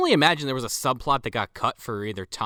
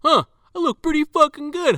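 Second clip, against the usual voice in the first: high, louder imitation of self-admiring surprise; impressed self-admiration; 5 dB louder than usual. Imitating Sean checking his body in the mirror, impressed. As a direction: marvel at yourself, pitched way up. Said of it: "looking in the mirror at his body".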